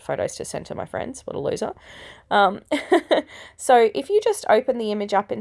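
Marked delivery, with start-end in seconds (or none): 2.7-3.2 short, higher-pitched chuckle; a quick little laugh at yourself; self-mocking laugh. Real, not acted. Tutorial host laughs after calling herself a loser, self-mocking chuckle.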